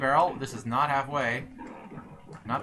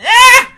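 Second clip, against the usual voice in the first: sudden high loud shriek; startled yelp; 21 dB louder than usual. Real, not acted. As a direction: yelp in shock. Sudden yelp during game.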